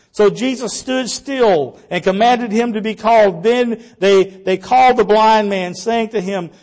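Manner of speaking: normal talking voice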